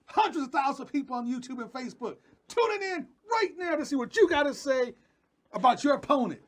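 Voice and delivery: high-pitched, driving hype build-up; hyped live interviewer. Pretend. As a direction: pump up the fighter like a live interviewer. Mock live interview hype, demanding smack talk.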